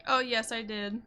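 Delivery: ordinary talk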